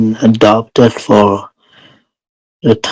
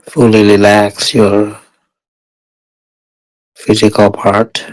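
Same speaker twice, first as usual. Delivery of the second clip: guided meditation. Real, not acted. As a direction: guide meditators step by step, unhurried and even.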